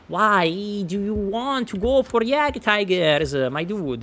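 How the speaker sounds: hammed-up Italian, pleading with the player; Italian accent. Caster scolds a player's Jagdtiger buys in a mock Italian accent. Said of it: "Now I have become Italian".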